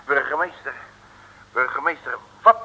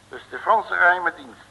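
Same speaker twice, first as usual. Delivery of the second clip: ordinary talk, nothing marked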